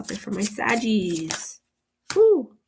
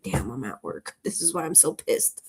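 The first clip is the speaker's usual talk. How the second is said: grumbling to self; 6 semitones lower, 6 dB quieter than usual. Retold or imitated.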